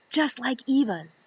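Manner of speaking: ordinary delivery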